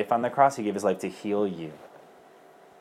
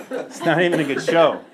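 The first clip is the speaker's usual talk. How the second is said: higher, louder words through laughter; laughing; 7 semitones higher, 6 dB louder than usual. Real, not acted. Speaker mocking the show, laughing through it. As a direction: laugh while admitting it.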